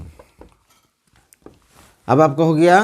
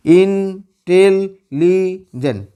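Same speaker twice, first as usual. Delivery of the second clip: Intelligent in four long, level beats; syllable by syllable; much slower than usual. Shown as a demonstration. Teacher breaking a word for students, intelligent split into syllables. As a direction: slowly hold "इन... टेल... ली... gent" with gaps. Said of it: "चार syllable है".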